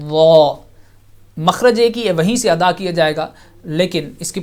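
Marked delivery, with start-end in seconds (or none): 0.1-0.5 heavy but soft consonant; وا with a heavy, soft sound; thick soft letter. Shown as a demonstration. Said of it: "موٹا رکھا جائے گا لیکن soft".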